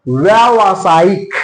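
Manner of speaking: व्यावसायिक slowly, with a long, stretched व्या; correct pronunciation. Shown as a demonstration. Teacher showing students the right word, slow correct pronunciation. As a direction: sound out व्यावसायिक part by part, long आ after व्य. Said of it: "ये सही है".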